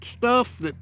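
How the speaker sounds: put-on old hillbilly voice. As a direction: play a clueless old hillbilly. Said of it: "old man hillbilly voice".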